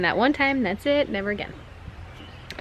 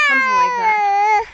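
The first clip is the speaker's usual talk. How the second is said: high, loud startled yelp; sudden loud cry; 14 semitones higher, 7 dB louder than usual. Real, not acted. Walking with the baby, breaking off into a loud high cry. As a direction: blurt it out at full volume. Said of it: "That was very loud".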